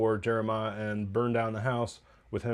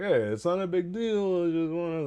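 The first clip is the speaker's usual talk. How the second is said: East Coast Italian; 7 semitones higher than usual. Retold or imitated. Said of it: "very, like, East Coast Italian thing".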